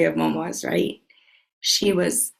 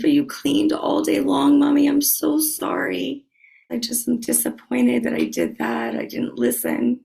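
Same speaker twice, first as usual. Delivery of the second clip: daughter apologizing; 7 semitones higher than usual. Retold or imitated. Mother voicing her apologetic daughter in a higher girl's voice. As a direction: play the eleven-year-old sorry about the milk.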